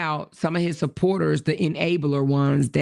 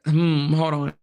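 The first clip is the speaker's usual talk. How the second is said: ordinary talk, nothing marked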